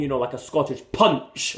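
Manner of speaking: a lightly put-on Scottish accent, slowed down; self-mocking stab at a Scottish accent. Shown as a demonstration. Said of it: "that's a very poor Scottish accent".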